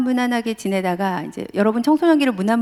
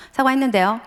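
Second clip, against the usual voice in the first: put-on a sullen teen's curt answer, mildly raised; a son answering his father in the car, mildly sulky; sulky reply; 4 semitones higher than usual. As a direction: brush it off, a bit defiant. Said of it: "점점 서로 격해".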